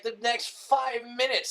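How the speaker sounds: usual speaking voice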